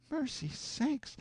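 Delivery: slow, high, hushed gasp of dismay; shocked exclamation. A narrator's reading. Aunt reacting to the boys' story, high and aghast. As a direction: let it drop out of you in disbelief. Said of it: "aunt exclaims".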